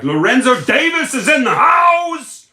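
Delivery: high, loud announcer-style holler; big hype call, soaring in pitch; hyped shout-out. Real, not acted. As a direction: shout the arrival, stretching the last word. Announces a viewer joining.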